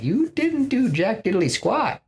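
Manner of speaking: high-pitched accusing jab, acted out; hammed-up scolding, pitch leaping high; mock indignation. Resentful Asgardian to absent king Thor, mock indignant.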